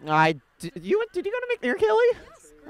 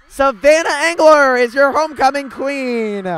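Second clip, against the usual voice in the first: excited announcement; 5 semitones higher, 5 dB louder than usual. Real, not acted. Announcing homecoming queen live.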